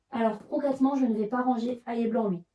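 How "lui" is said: normal talking voice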